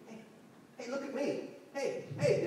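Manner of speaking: put-on high-pitched kid goading a tiger; taunting kids. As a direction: pester the animal for attention.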